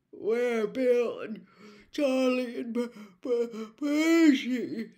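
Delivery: slow, sleepy, through a huge yawn. A narrator's reading. George asks about his brothers through a huge yawn. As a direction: drag the words out mid-yawn. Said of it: "failing to stifle a huge yawn".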